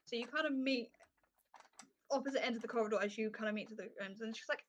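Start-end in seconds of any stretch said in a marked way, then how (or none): none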